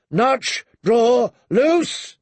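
high, ringing one-word orders; battle drill shouted at archers; shouted commands. A narrator's reading. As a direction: call each order out, last one highest.

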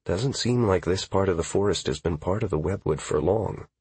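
ordinary delivery, nothing marked

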